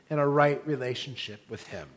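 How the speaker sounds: ordinary delivery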